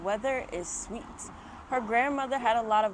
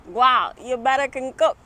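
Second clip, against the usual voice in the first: loud Jamaican granny voice; Jamaican accent; 5 semitones higher, 11 dB louder than usual. Acted. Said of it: "channel my Jamaican accent".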